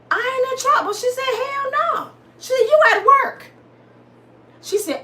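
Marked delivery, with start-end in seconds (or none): 0.1-0.9 very high-pitched astonishment; comic, amazed falsetto; incredulous. Retold or imitated. Acts out astonished question to boss. 1.2-3.5 very high-pitched exclamation; hammed-up high-pitched boss; emphatic denial. Acts out boss's emphatic answer.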